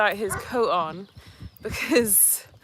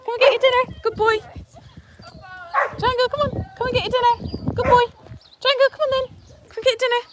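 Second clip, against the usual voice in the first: high, loud sing-song dog call; 10 dB louder, faster than usual. Real, not acted. Calling dog to dinner.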